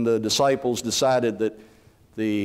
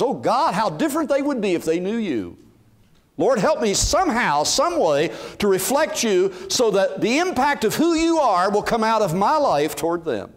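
earnest prayer; 7 semitones higher than usual. Retold or imitated. Prayer voiced as first thought.

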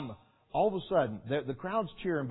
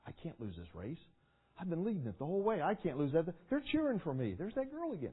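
mild panic; 3 semitones higher, 7 dB quieter, faster than usual. Retold or imitated. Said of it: "from elation to panic".

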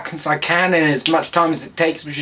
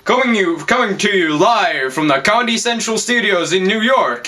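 higher-pitched live studio announcer; TV show announcer; 3 semitones higher than usual. Acted.

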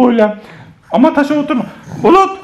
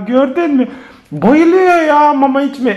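usual speaking voice

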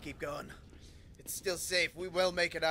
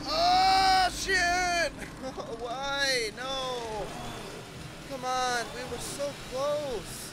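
frustrated yelling; 6 semitones higher, 7 dB louder, much slower than usual. Real, not acted. Caught again, frustrated.

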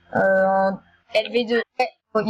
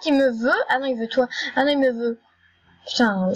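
usual speaking voice

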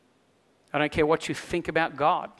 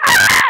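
loud, high mock "Ah!" to startle; playful scare shout; 13 dB louder than usual. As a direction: jump out and roar at the kids. Jumping out to scare kids.

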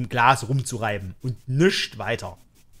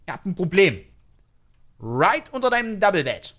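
normal talking voice

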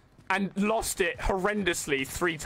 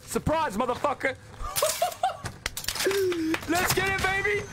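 excited shouting; 8 semitones higher, slower than usual. Real, not acted. Streamer yelling after surprising an enemy player, excited shout. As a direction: whoop it up after the kill. Celebrating a kill.

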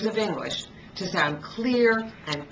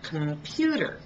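computer with the outer vowels lost; teacher showing the reduced first and last syllables. Shown as a demonstration. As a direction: swallow the com and ter in computer, stress pu. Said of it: "Listen again".

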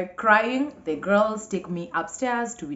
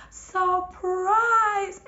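high, loud group yell of Surprise; spring it on her with a yell; surprise shout; 14 semitones higher, 3 dB louder than usual. Retold or imitated. Friends ambushing the bride-to-be, loud high shout.

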